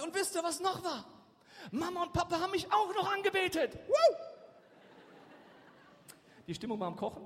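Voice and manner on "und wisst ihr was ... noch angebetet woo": high, loud, gleeful bragging; hammed-up boast building to a whoop; Josef. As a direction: show off shamelessly, shouting high. Josef boasts further to his family.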